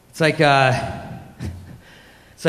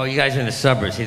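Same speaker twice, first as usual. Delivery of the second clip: ordinary talk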